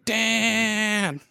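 a hint of Roseanne calling out; Roseanne yelling. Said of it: "Roseanne yelling".

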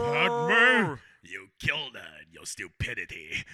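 loud, hammed-up movie villain grief; Darth Vader. Mocking his friend as a grieving movie villain, loud ham. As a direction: boom it like a fallen Sith lord. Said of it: "like Darth Vader".

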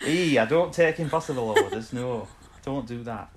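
hammed-up northern, laughing through it; northern English accent. Host joking after own slip, broad northern accent. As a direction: play it broad northern, slowing at the end. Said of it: "went a bit northern at the end".

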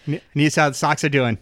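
put-on quick, broad Boston accent. Boston Doctor Strange checking on the Sox, quick local accent. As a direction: rattle it off like a Boston sports fan. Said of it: "the Boston version".

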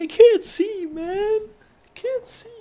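very high, squeaky mock wail; mock-helpless falsetto plea, fading; imitating injured fighter. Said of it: "They had to stop the fight 'cause".

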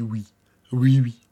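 mocking a servile underling; meek yes-man. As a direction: grovel to the boss, eager to please. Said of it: "Il dit".

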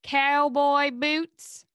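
slow, drawn-out Southern cowboy boots; Southern twang. Shown as a demonstration. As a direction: stretch the vowels in a Southern twang. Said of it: "do your best Southern accent".

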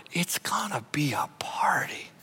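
soft unvoiced whisper. Real, not acted. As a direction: tell it like a delighted secret. Excited secret aside.

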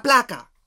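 sharp high mock gunshot, placa; imitated gunfire, placa; gunshot noise. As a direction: spit out placa as a sudden high shot. Imitating gunshots in retold fight.

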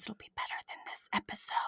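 fast hushed aside; whisper. Real, not acted. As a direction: whisper it like a guilty secret. Whispered aside about bad episode.

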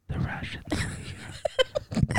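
slow, very close whisper. Acted. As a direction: breathe it slowly right by the sleeper's ear. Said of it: "and she whispered".

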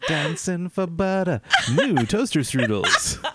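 slow, drawn-out mock ad tagline; mock slogan. Co-host mock-announcing a breakfast ad slogan, slowly. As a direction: savour each word like a jingle.

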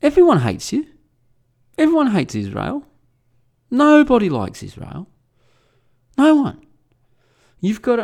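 high, emphatic mocking jabs; mocking retort. Answering his worry about world opinion, high emphatic mockery. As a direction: punch each taunt high and hard. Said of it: "I just started mocking him openly".

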